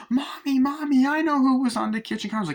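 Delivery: put-on high, loud, eager little boy; high, excited child voice calling Mommy; excited kid voice. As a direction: squeal it out like a kid bursting with news. Andy the child telling mom.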